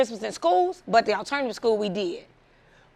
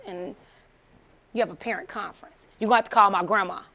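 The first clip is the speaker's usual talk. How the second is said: ordinary talk, nothing marked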